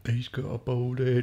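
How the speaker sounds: slow imitation of drunken taunting; drunk lad. Echoing the story's drunk lads, high slow jeer. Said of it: "very drunk".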